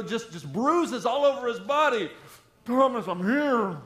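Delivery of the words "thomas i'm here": mock ghoulish Jesus. Imagined wounded Jesus voice.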